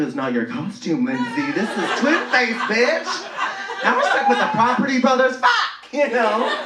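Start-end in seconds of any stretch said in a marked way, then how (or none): 1.5-6.6 hammed-up indignant queen; mock diva outrage.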